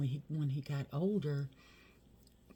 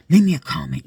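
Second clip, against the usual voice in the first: much louder repeat, calling out "Leave me a comment"; loud demand; 17 dB louder, faster than usual. Real, not acted. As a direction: shout the request at the viewers. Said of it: "And leave me a comment".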